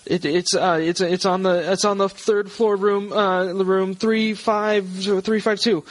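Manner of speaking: high, flustered, stumbling answer; distressed wife. Acted. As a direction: answer haltingly as a panicked woman.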